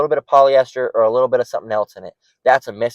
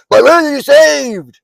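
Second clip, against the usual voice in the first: much higher, slow, sneering mock voice; mocking a heckler; 12 semitones higher, 6 dB louder, slower than usual. Preacher mimicking a bug out questioning salvation, higher sneering voice. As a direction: drag it out like a smug know-it-all. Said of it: "some bug out in the woodwork".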